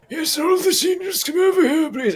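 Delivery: put-on a touch of old wizard; elderly wizard voice. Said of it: "the elderly wizard voice".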